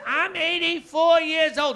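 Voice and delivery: put-on high, loud, slow defiant old mom; stubborn old mother. Elderly mother refusing the booster seat, high loud defiance. Mother protests her age.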